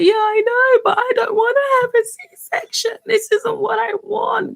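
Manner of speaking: high, sobbing and breaking; crying. Retold or imitated. Telling her friend on the phone, crying. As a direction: cry it out, pleading not to have it.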